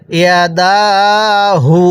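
"ইয়া দা হু" with a long held দা; drawn-out vowel. Shown as a demonstration. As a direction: stretch the দা in "ইয়া দা হু". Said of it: "এই টানের নিয়মগুলো".

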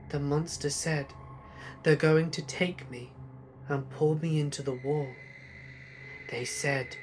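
put-on soft, frightened child confiding; hushed, dropping away softly by the end; hushed fear. A narrator's reading. Daughter tells mother about the monster, scared and hushed. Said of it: "I'm scared".

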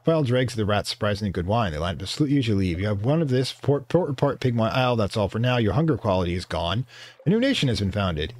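ordinary delivery

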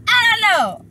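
high, loud outburst; loud, high-pitched "who knows"; exclaimed bafflement. Real, not acted. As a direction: throw it out in loud mock exasperation. Exclaimed shrug after laughing.

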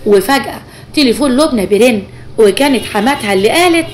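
usual speaking voice

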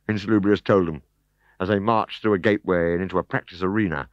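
ordinary delivery, nothing marked

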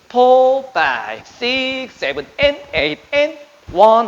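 punchy counted beats called out; barking gym coach. Shown as a demonstration. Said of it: "counting full routine to beat".

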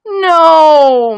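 long, loud, high wail of refusal; mum's hammed-up despairing howl; dismayed groan. A narrator's reading. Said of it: "my mum groaned".

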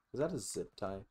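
usual speaking voice

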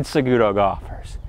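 ordinary delivery

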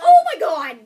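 high, very loud startled cry; startled yell. Real, not acted. Player startled mid-game, shocked yell.

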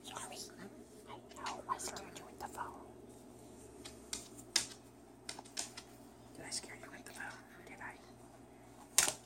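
soft apologetic whisper. Real, not acted. Owner whispering sorry after scaring the bird. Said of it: "Sorry, didn't mean to scare you".

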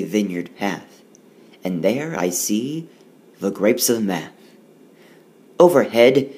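lively, sing-song riddle read aloud; poem reading. Acted. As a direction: read it like a playful rhyme for kids. Reads rhyming poem aloud.

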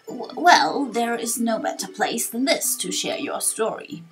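slow, wavering elder with drawn-out words; wise old sage. Acted. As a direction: play a kindly old sage pondering aloud. Voices old sage Torkoal.

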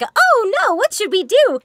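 played-off alarm. Shown as a demonstration. Game voice asking kids to help the firefighter, playful alarm. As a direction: ham up the worry so it stays fun. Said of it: "it's a little bit played off".

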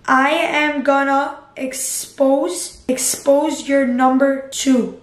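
drawn out word by word; type-along slow threat; slow dictation. Real, not acted. Typing a threat message.

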